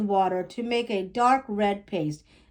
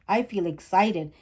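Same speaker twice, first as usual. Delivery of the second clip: usual speaking voice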